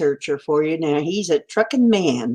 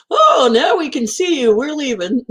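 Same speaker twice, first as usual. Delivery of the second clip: high joking viewer voice; high silly voice; 9 semitones higher than usual. Pretend. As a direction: squeak it out playfully.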